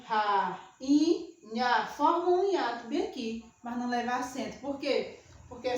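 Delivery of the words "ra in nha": "ra, in, nha" broken apart, with a lift on "in"; syllable by syllable. Shown as a demonstration. Teacher splitting rainha for students, one syllable at a time. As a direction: spell out rainha beat by beat. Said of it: "Ele é tônico".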